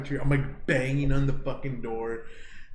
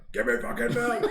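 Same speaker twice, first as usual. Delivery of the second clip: high, strained mock plea; hammed-up desperate demand; 9 semitones higher than usual. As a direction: beg for it as if in agony. Acting out desperate demand for milk.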